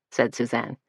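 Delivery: ordinary delivery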